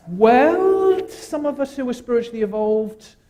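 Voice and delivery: high, loud, mock-superior voice; high hammed-up self-satisfied tone; mock lofty. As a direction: put on airs as the enlightened one. Mocking spiritually evolved people.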